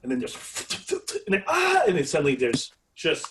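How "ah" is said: high, loud short scream; a startled shriek breaking out; sudden scream. Acted. Sudden attack in the scene.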